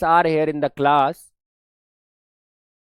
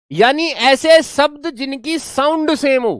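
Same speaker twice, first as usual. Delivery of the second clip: higher, louder, slow definition; teacher stressing the definition, high and slow; emphatic definition; 7 semitones higher, 4 dB louder than usual. Shown as a demonstration. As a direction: drive the key definition home. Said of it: "defining key term".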